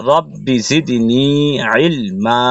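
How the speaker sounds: slow রব্বি জিদনি ইলমা, long final vowels; drawn-out madd. Shown as a demonstration. Teacher reciting the whole phrase, slow held vowels. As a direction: stretch the ends of জিদনি and ইলমা. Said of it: "এক লিপ টেনে পড়তে হবে".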